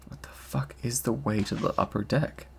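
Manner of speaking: hushed, frustrated whisper. Real, not acted. Player muttering while lost on the airship, whispered. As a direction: whisper to yourself, lost on the ship. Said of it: "lost in game, whispering".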